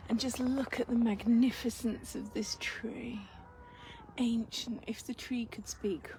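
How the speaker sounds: hushed whisper in awe; reverent whisper. Real, not acted. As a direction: whisper it with wonder. Awe under the ancient tree.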